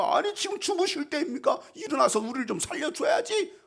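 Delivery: high-pitched panicked protest. Acted. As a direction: plead indignantly in a high, thinner voice. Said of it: "이렇게 항의하고". (높은 목소리로 항의하듯)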